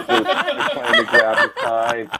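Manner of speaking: high, slow words squeezed out through laughter; laughing. Real, not acted. Telling the podcast host the story while cracking up, high and slow.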